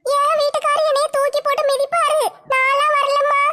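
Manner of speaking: high-pitched put-on voice, begging off; high, sustained voice of refusal; reluctant wife character. Another character refuses, fearing her husband.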